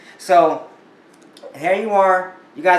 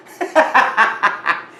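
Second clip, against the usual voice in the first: laugh; 6 semitones higher, 5 dB louder than usual. Real, not acted. Laughs at own joke.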